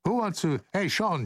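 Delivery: ordinary talk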